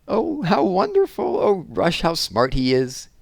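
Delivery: delighted gasp. A narrator's reading. Girl delighted by the begging dog, high gasping delight. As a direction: gush over the dog's trick. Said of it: "gasped Randy".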